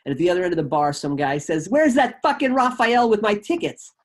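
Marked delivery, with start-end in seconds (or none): 1.7-3.9 loud, high furious yell across the bar; angry yell. Retold or imitated. Guy at the bar yelling for his tickets, furious shout. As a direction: bellow it down the bar, fed up.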